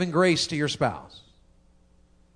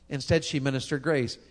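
ordinary talk, nothing marked

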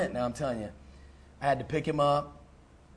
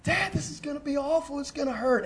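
put-on high, soft, frightened little-boy voice; scared child; 11 semitones higher, 4 dB quieter than usual. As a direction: whimper it like a terrified kid. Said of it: "Brent is beside himself".

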